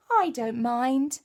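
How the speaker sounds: ordinary talk, nothing marked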